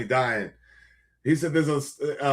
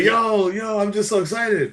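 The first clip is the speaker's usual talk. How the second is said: excited; 6 semitones higher than usual. Retold or imitated. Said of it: "He's like".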